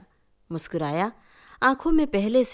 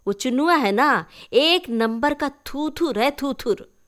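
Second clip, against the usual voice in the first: put-on a girl's voice, higher and louder, teasing; chatty village girl; 4 semitones higher, 5 dB louder than usual. Titri teasingly explains nicknames to Mala, higher and louder. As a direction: gossip with relish, drawing out the words.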